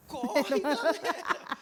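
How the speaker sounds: loud panicked yell, laughing through it; shouting to flee. Retold or imitated. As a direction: yell it as you run for your life. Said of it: "Aí eu fiz que nem o irmão teu".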